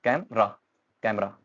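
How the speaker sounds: कैमरा split as "kam-ra"; two syllables only. Shown as a demonstration. Teacher counting syllables for learners, two-beat कैमरा. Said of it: "two syllable बन रहे हैं only".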